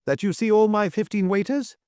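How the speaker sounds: very high, astonished question; quaking disbelief, pitch way up; stammering surprise. A narrator's reading. Hotel keeper questioning the duke, astonished. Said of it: "he stammered".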